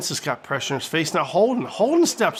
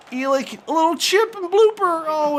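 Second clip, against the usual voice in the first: excited commentary; 10 semitones higher than usual. Real, not acted. Chip attempt in commentary.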